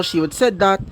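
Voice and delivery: usual speaking voice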